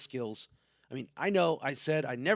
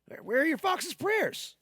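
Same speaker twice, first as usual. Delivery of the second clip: much higher, slow squeaky question; mock viewer voice; 12 semitones higher, slower than usual. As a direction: squeak it like a nitpicking fan. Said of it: "I see what's missing".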